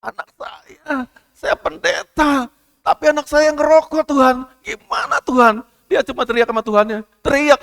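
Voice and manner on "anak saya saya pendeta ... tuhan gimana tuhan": high, slow, tearful pleading to God; retold cry of a desperate father, voice breaking; crying prayer. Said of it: "nangis sama Tuhan".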